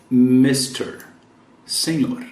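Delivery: ordinary talk, nothing marked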